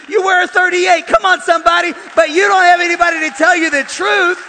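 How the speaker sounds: loud, high, emphatic sermon shout; full-force preaching. Real, not acted. Preacher telling congregation the truth about themselves, full-force shouting. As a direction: build and belt it out to the whole room.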